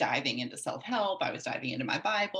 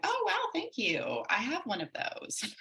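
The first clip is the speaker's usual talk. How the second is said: mock naive thanks; 9 semitones higher than usual. To the counselor handing her a book, mock naive thanks. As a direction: play it cluelessly pleased. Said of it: "my first response was like".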